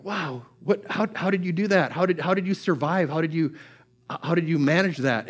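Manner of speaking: soft, halting, stunned questions; hushed amazement. Acted. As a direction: ask it quietly in disbelief. Onlookers stunned by the survivor.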